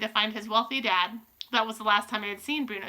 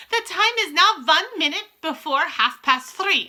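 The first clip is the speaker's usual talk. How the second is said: slow, high, loud proclamation; measured, commanding witch voice; grand announcement; 6 semitones higher, 6 dB louder than usual. A narrator's reading. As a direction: announce it like a ceremonial countdown. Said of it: "announced the Grand High Witch".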